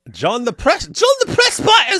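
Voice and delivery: high, loud announcer reading the name; hammed-up announcer. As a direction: announce the name like a game host. Hams up a username.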